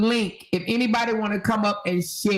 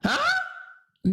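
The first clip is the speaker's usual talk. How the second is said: incredulous huh; 3 dB quieter than usual. Real, not acted.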